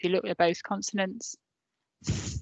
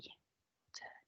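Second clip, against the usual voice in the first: a soft whispered prompt to the class; 29 dB quieter than usual. Real, not acted. Teacher whispering the cue to her pupils. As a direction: breathe it, barely voiced.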